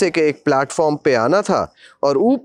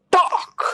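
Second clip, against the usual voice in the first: snap sound; much slower than usual. Retold or imitated. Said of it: "जोर से snap सुनाई".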